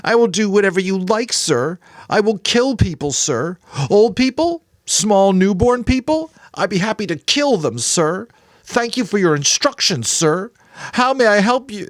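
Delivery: high, loud mocking servile voice; boy lashing out in bitter sarcasm; cruel sarcasm. A narrator's reading. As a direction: spit out the mock obedience bitterly. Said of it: "mimicked in a cruel, sarcastic voice".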